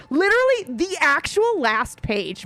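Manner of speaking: ordinary delivery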